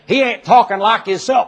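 lightly voiced Southern country man. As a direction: do the country boy talking. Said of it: "He says".

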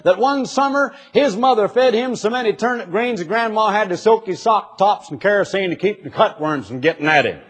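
lightly voiced Southern country man bragging. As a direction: tell it like a poor country boy. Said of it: "He says".

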